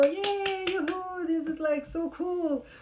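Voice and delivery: high mock cheer; hammed-up excited officer. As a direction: squeal it like a giddy cop.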